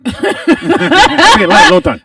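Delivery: slow, high mock-Japanese English, laughing; mock Japanese accent. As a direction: drag out the complaint in a hammed-up Japanese accent. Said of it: "Japanese, just".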